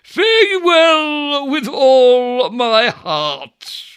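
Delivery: slow, loud, ponderous oaf; mimicking Ajax. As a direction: drag each word out like a self-important dullard. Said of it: "I'll put on his presence".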